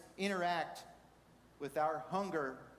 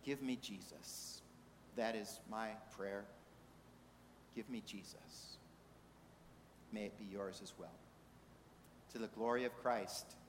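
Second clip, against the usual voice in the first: low, soft, prayerful sermon ending; quiet closing prayer; 8 semitones lower, 9 dB quieter than usual. Real, not acted. Preacher closing the sermon with a personal prayer, quiet and prayerful. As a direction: let each phrase settle, hushed and reverent.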